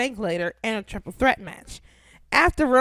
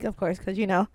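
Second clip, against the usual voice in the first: ordinary talk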